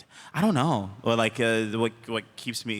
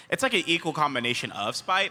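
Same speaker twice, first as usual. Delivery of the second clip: normal talking voice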